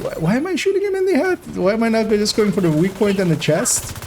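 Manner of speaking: high, fast baffled self-questioning; baffled at himself. Retold or imitated. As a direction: squeak it out in disbelief. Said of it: "and I was going".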